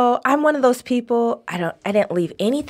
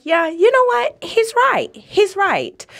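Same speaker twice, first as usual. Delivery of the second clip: realization; 10 semitones higher than usual. Retold or imitated. Retelling her own realization.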